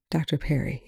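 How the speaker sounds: soft, hushed address; quietly. A narrator's reading. Young gently addressing the tearful doctor, quiet. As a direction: speak her name quietly. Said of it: "Young says quietly".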